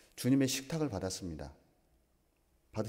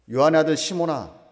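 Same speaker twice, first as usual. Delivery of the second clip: calling out; 5 semitones higher, 11 dB louder than usual. Acted. Preacher voices Jesus calling Peter.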